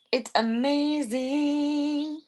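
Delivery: high, long drawn-out gush; gushing amazement. Pretend. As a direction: stretch it high in sing-song wonder. Gushing over pet's tail.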